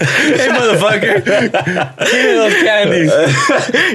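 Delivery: hammed-up demand, dragged out with giggles; mock tough guy. Voicing a man demanding candy, laughing mock tough guy. Acting out the tiger owner.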